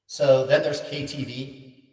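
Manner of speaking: slow delivery with echo; KTV echo effect. Shown as a demonstration. Streamer demonstrating the KTV setting, echoing voice. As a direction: draw it out through karaoke echo. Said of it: "a lot of echo with that one".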